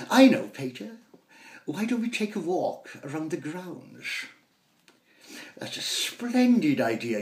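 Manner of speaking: eagerly plan a walk round the grounds; mock-aristocratic father and son; posh family chatter.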